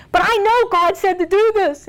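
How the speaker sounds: high-pitched pleading whine; whining. Acted. Wife insisting to her husband that God said so, whining. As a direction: whine it, pushing to get your way. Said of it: "And you whine and you whine".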